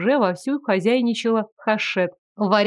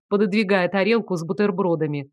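usual speaking voice

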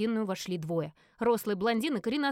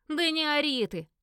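raised, drawn-out rebuke; irritated shushing; 5 semitones higher, 4 dB louder, slower than usual. A narrator's reading. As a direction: tell off a noisy little sister. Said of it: "цыкнул на неё". (раздражённо цыкнуть, с нажимом)

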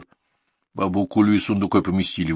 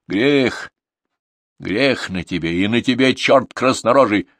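cursing; 3 semitones higher, 5 dB louder, slower than usual. A narrator's reading. Crone curses Mikhail.

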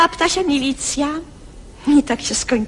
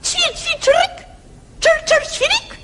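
high chirrup; sparrow chirping; slower than usual. Acted. Sparrow chirping at the other birds, high. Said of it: "Na to wróbel zaterlikał".

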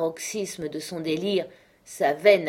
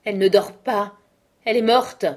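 much louder, higher despairing shout; father discovering his daughter dead; anguished cry; 4 semitones higher, 9 dB louder than usual. A narrator's reading. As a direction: cry out the truth. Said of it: "d'une voix qui fit tressaillir Benjamin".

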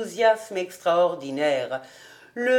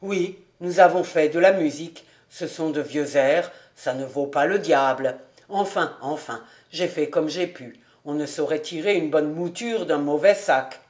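lower, fast, embarrassed voice; a hint of a flustered lower man; Fritz voiced; 6 semitones lower than usual. A narrator's reading. Fritz brushing off his music to Suzel, lowered voice.

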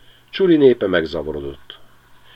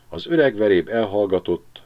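plain reading voice

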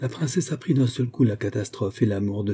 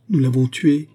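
higher, louder shocked confession; horrified; 5 semitones higher, 6 dB louder than usual. A narrator's reading. Constantin haggard on the grass, horrified. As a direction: blurt out the guilt.

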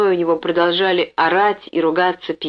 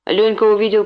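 ordinary delivery, nothing marked

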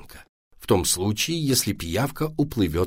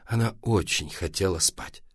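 plain reading voice

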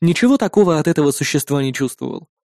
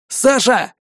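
shouted name; 8 semitones higher than usual. Acted. Someone cries out in battle.

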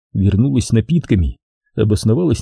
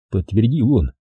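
plain reading voice

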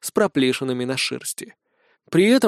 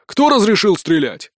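angry shout; 5 semitones higher, 4 dB louder than usual. Acted. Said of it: "взревел капрал".

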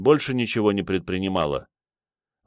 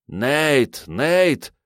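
agitated, higher-pitched cry of a name; anxious calling out; 7 semitones higher than usual. Acted. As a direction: call out to a hurt friend in alarm. Dragon anxiously calls injured narrator.

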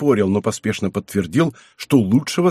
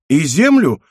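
higher, innocent asking; naive question; 5 semitones higher, 6 dB louder than usual. A narrator's reading. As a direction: ask it naively, pitched up. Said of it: "наивно спросил Мацко".

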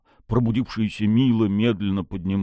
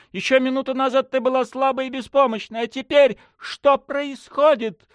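voice breaking. A narrator's reading. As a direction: voice cracks with fear. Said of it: "воскликнула Настя срывающимся голосом".

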